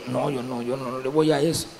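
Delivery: low, soft demurral; quiet, understated turning down of an offer; acted meek refusal. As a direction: drop the voice and back away. His quiet refusal of drugs, retold.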